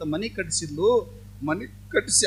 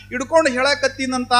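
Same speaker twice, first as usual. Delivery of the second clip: ordinary delivery, nothing marked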